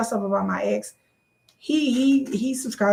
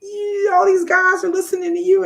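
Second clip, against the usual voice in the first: high-pitched, louder imitation of an angry ex; mock-angry ex; 11 semitones higher, 4 dB louder than usual. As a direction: mimic a jealous ex complaining, pitched way up. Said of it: "he would be so angry at me".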